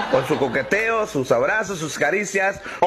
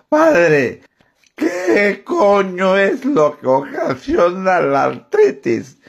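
put-on slow, slurred drunk voice; drunkard; 5 dB louder, much slower than usual. Drunk asks the priest on a bus, slurring slowly. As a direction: drag the words out like a drunk. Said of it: "El borracho que se sube en un colectivo".

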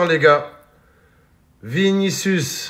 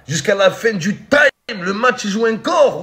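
ordinary talk, nothing marked

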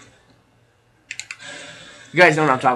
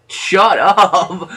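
laughing; 5 semitones higher, 5 dB louder than usual. Real, not acted. Mocking the cussing character.

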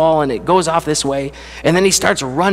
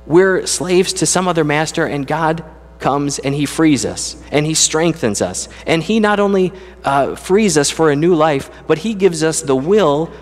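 preaching with mounting fervour, pitched higher; rising preacher voice; 5 semitones higher than usual. Acted. Preacher telling his congregation what God gives them, fervent and building. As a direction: lift the congregation with each line, building.

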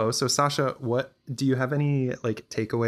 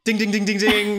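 high, loud bell-like dings; game show ding; 10 semitones higher, 5 dB louder than usual. Pretend. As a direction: ring it out like a quiz show bell. Quiz host rings correct answer.

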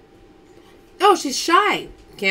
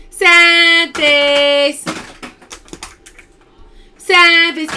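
announcer call; 3 semitones higher, 7 dB louder, much slower than usual. Real, not acted. Announcing a losing game, drawn out.

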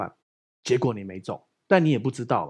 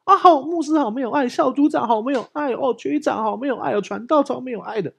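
higher, pouty mock grumble; mock grumbling believer; 8 semitones higher than usual. Mimicking church members grumbling that leaders lack love, pouty. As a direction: whine it like a hurt churchgoer. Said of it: "他会觉得跌倒".

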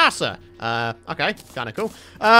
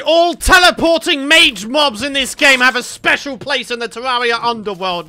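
ordinary talk, nothing marked

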